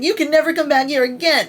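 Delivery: high, loud, fast pouty quote; pouting. Retold or imitated. Reader quoting a pouting heroine, high and fast. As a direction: sulk it out like a wounded heroine. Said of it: "She is kind of pouting because she's like".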